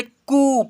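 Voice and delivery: "कु" held as a long "koo"; lengthened "koo" on "कु"; long vowel. Shown as a demonstration. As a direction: stretch the u in "कु". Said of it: "बड़ा ऊ का उच्चारण थोड़ा सा अधिक होता है".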